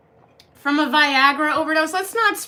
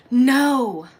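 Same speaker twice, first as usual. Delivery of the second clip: loud, abrupt no; firm exclaimed refusal; emphatic refusal; 6 dB louder than usual. Real, not acted. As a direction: shut the request down loudly. Refuses a request to flash.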